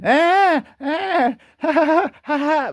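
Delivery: put-on high, jeering little-kid cackle; high mocking laugh; mocking kid laughter. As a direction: laugh at the bear like a bratty child. The West mocking the bear.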